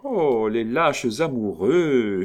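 slow, loud, high scornful cry; mock impatience. Countess scolding the shy notary, hammed-up impatience. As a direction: play-act exasperation, drawn out. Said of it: "avec une impatience admirablement jouée".

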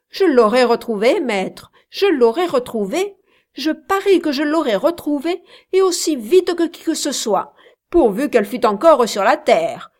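high, loud, excited bragging; loud boasting. A narrator's reading. Kit loudly boasts he would have found Nell, excited. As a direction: brag that nobody could beat him.

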